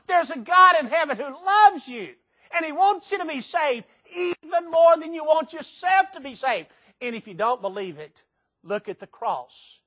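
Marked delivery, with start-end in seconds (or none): 0.1-6.7 high, loud, near-crying cry of conviction; impassioned shout. Real, not acted. Preacher crying out to the congregation about God's love, impassioned shout. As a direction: let it break out high and loud, close to tears.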